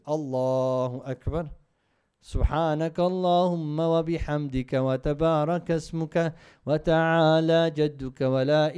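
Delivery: chanted Arabic prayer recitation, loud and sustained; Quran recitation. Shown as a demonstration. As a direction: recite it as in prayer, carrying each verse.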